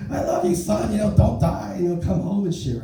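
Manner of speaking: high, wailing imitated mother; crying mom. Son imitating his mom at the car, high wailing farewell. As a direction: pitch it way up like a sobbing mom.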